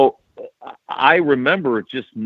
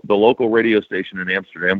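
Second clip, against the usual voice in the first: ordinary talk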